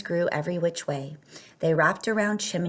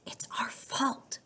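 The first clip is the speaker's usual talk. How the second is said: soft unvoiced whisper, much quieter; 7 semitones higher, 9 dB quieter, slower than usual. A narrator's reading. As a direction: breathe it guiltily with no voice. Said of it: "whispered Jose".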